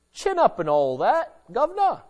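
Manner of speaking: a hammed-up posh British "chin up"; mock British cheer. Preacher mocking a dismissive friend, hammed-up British cheer. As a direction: brush off grief like a stiff-upper-lip Brit.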